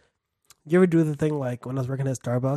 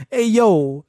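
mock pizza guy; 5 semitones higher, 9 dB louder than usual. Pizza guy greeting, loud.

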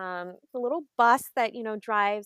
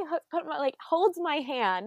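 ordinary talk

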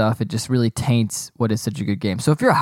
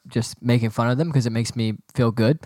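usual speaking voice

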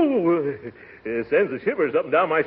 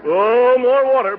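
loud call; 9 semitones higher, 7 dB louder than usual. Acted. Desperate call for water.

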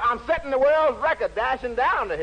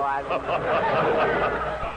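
laughing; 8 semitones lower, much slower than usual. Real, not acted. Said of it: "repeats line with laugh".

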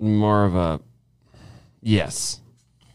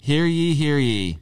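proclaiming like a town crier; mock town crier announcement; 6 semitones higher, slower than usual. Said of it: "you're on top of the soapbox. Go".

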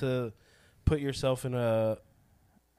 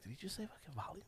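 fast whispered disbelief; 22 dB quieter, faster than usual. Retold or imitated. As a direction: whisper it quickly, stunned. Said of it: "and be like".

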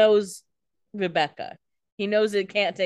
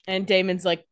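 ordinary talk

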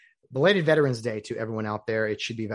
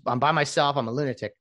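ordinary talk

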